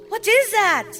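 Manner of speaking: ordinary delivery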